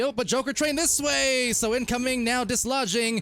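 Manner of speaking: high, urgent commentator call; excited play-by-play. Acted. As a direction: ride the attack up, then settle on the goal. Live play-by-play.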